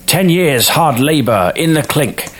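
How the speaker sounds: high-pitched pronouncing judge; storybook judge, voice pitched well up; judge passing sentence. A narrator's reading. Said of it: "A judge would say without a blink".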